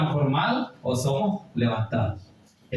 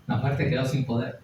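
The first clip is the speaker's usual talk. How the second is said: ordinary talk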